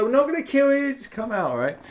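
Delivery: coaxing captor. Retold or imitated. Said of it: "And, like".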